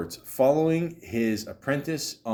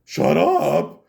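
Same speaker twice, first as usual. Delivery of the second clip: loud higher yell as a grumpy father; mock Howard Stern's father; 6 semitones higher, 7 dB louder than usual. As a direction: bark it like an angry old dad. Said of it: "Howard Stern's father".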